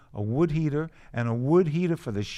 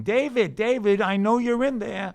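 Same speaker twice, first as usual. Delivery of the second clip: high, loud imitation of her yelling; retold yelling; 9 semitones higher, 5 dB louder than usual. Comic as his ex outside the apartment door, mock yelling. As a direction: call out louder, pitched well up, mimicking her. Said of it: "And she's yelling".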